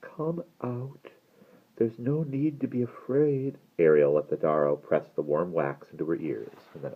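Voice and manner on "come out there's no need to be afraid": high, soft coaxing from afar; falsely sweet, high call; trying to sound pleasant. A narrator's reading. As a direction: coax gently, high and distant. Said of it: "He was trying to sound pleasant".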